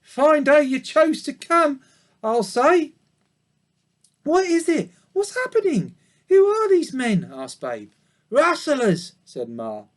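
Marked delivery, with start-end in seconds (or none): 0.0-2.9 put-on high, louder old ewe; old sheep voice. A narrator's reading. 4.2-7.3 put-on very high young piglet asking. A narrator's reading. Babe the piglet asks. 8.3-9.1 put-on higher old ewe voice; old sheep voice. A narrator's reading. Ma answers.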